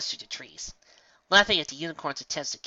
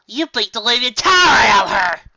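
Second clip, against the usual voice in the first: furious yell; 10 semitones higher, 16 dB louder than usual. Acted. Filly accusing her sister.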